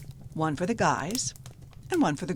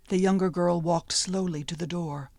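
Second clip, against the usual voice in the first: plain reading voice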